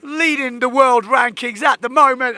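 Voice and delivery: usual speaking voice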